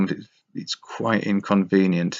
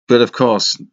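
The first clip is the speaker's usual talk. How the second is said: normal talking voice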